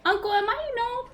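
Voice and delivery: put-on high, puzzled child voice; a little gnome boy asking his uncle. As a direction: ask your uncle like a small child.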